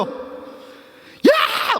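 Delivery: sharp high shout, breathy and forced; yelling at him. Retold or imitated. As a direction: bark "야!" as a panicked disciple. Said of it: "막 소리 지르지 않을까". (제자가 주님께 다급하게 소리침)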